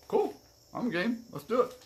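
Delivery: bright, high eager yes. Retold or imitated. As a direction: jump at the offer. Eager reply to driving offer.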